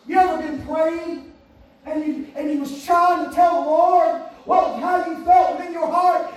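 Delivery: impassioned, driving sermon delivery; full-force preaching. Acted. Preacher describing pouring a heart out to God, impassioned. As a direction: preach it hard in surging phrases.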